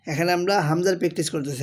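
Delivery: normal talking voice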